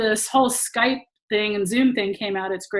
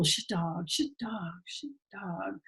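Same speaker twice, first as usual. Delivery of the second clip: fading repetition; 3 semitones lower, 15 dB quieter, slower than usual. Retold or imitated. Repeating the new name in disbelief, fading to a whisper. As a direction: repeat it softer each time until it's a whisper.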